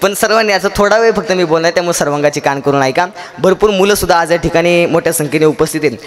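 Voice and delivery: ordinary talk, nothing marked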